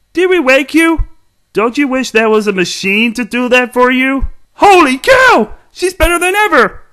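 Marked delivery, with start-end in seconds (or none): 4.6-6.8 astonished outburst; amazed exclamation. Acted. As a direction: gasp it out in delight. Amazed at the repaired robot.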